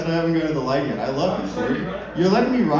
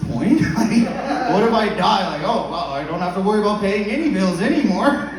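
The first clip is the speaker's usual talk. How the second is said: laughing; 6 semitones higher, 6 dB louder than usual. Retold or imitated. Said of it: "I'm like".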